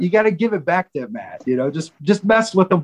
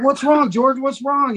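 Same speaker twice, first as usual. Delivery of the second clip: higher, hammed-up worried asking; fake concern; 8 semitones higher, slower than usual. Band members feigning worry to George, mock concern. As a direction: pretend alarm to fool Ed. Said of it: "we're like".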